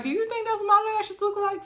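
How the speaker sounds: high, incredulous teasing question; mock offended. Imitating Evelyn teasing Puff about his drawing, high incredulous tone. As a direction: play her half-laughing, half-insulted.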